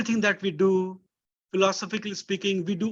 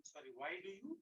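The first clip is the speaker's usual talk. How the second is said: faint and far away; very low voice over a bad line; barely audible; 25 dB quieter, faster than usual. Real, not acted. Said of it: "[name], your voice is very low".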